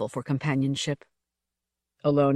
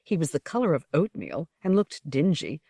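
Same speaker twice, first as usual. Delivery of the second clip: plain reading voice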